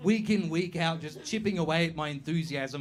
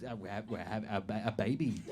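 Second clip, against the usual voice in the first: much lower and softer, halting dopey voice; mock dimwit; 9 semitones lower, 9 dB quieter than usual. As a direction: stammer like you barely know. Playing dumb to a stranger.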